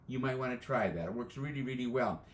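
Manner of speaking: ordinary talk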